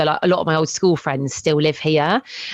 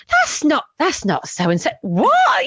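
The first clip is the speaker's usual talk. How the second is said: high-pitched astonished reaction; mock disbelief; 8 semitones higher, slower than usual. Reacting to old classmates' changed pictures, mock disbelief. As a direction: act out stunned double take, jumping high on the last word.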